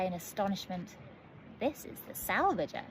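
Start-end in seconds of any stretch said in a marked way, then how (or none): 1.6-2.9 a touch of pride; proudly. A narrator's reading. Gus presenting his ship to the girls, proud. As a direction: announce the ship's name proudly. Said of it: "said Gus most proudly".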